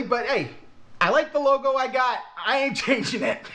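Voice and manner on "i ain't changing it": amused, breaking into a laugh; laughing refusal. Real, not acted. Vlogger defending his channel logo, laughing. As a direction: say it through a real laugh.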